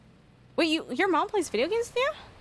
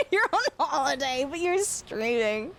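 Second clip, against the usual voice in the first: amused laughter; 4 semitones higher than usual. Real, not acted. Laughs at friend streaming on holiday.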